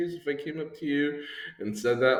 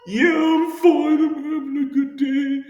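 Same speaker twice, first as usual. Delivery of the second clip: high-pitched wailing fake sob; hammed-up bawling, drawn out and slow; mock crying; 7 dB louder than usual. Crying person insisting they are fine, mock wail.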